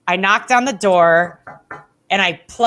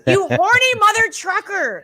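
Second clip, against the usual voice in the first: high-pitched comic scolding; high, indignant retold jab; exasperated mock insult; 10 semitones higher than usual. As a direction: hurl a playful insult, hammed up. Retold insult at lustful commentator.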